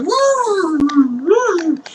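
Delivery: very high drawn-out mock wail. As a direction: wail "woo" in comic dismay. Lord reacts to mob threat.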